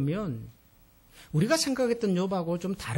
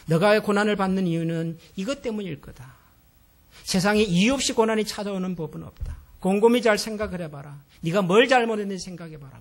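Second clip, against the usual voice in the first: accusing; 8 dB louder than usual. Retold or imitated. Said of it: "욥에게 따지듯이 물을 때".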